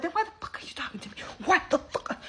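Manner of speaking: incredulous mock-furious whisper; whispered outrage. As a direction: hiss it under your breath, voiceless. Outraged aside in retold quarrel.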